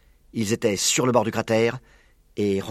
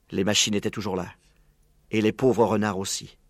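usual speaking voice

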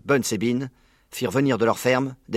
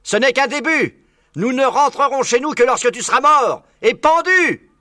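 loud, very high furious yell; shouting; 8 dB louder than usual. Acted. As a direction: scream the threat into the hole. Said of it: "en hurlant dans le trou".

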